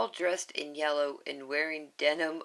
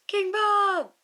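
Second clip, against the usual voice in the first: much higher, much louder yell; 9 dB louder than usual. Acted. Bob yelling to the confused crowd. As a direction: belt it out over the crowd. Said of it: "shrugs and yells".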